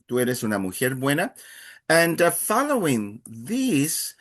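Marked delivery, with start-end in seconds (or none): 0.1-1.3 quick run-through of the phrase Tú eres una mujer buena; fast repeat. Shown as a demonstration.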